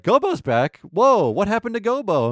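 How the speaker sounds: like spotting a lost friend alive; a reader amazed to see Gobo return; surprised delight. Retold or imitated. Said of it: "you're like".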